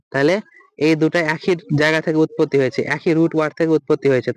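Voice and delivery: usual speaking voice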